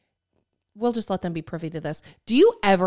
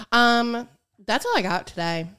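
usual speaking voice

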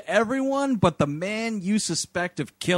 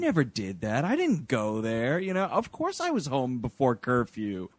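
low-pitched mock teen denial; lying teenager; 6 semitones lower than usual. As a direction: deny it like a teenager lying to parents. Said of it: "a teenager just lying to their parents".

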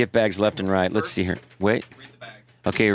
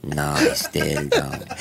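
slow, lower mock accent; mock Spanish accent; 6 semitones lower, slower than usual. Pretending to be Javier asking for chocolate, slow mock accent. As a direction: play Javier, slowly. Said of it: "you can just do the, the accent".

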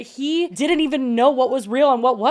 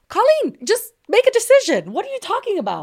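much higher and louder, fed up; exasperated outburst; 10 semitones higher, 4 dB louder than usual. Real, not acted. Host snapping at Colleen over her dithering, exasperated. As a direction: throw your hands up at her.

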